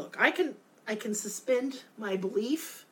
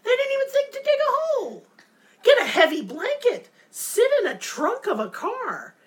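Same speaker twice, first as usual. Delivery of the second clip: higher and louder, can't believe it; incredulous rant; 13 semitones higher, 6 dB louder than usual. Real, not acted. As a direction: hammer home the easy way out. Ranting about the characters dying.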